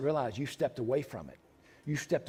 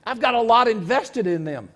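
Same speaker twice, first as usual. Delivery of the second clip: reluctant objector; 6 semitones higher, 9 dB louder than usual. Retold or imitated. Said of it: "some people say".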